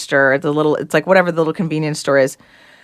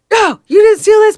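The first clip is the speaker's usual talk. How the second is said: higher, louder furious dad; dad flipping out; 5 dB louder than usual. Retold or imitated. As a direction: blow up at the kid for stealing. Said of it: "but it flips out on me".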